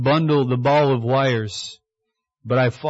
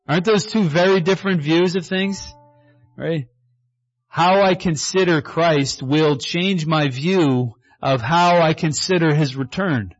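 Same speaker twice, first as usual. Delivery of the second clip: ordinary talk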